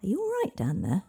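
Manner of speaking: high, loud call. A narrator's reading. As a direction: shout a question to someone far below. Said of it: "then added, for want of anything better".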